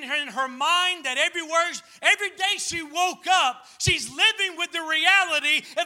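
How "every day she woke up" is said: ordinary delivery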